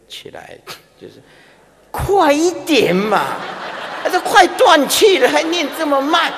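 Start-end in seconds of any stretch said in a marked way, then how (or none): 1.9-6.3 high, loud, laughing mock nagging; impatient dying man. Monk playing the dying man urging chanters faster. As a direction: hurry them up as the dying man. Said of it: "那个死人就会起来".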